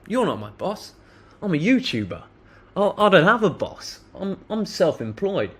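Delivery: mildly performed comeback, not a shout; retold defiant reply. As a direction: brush him off with a mocking lift. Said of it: "I said that twice".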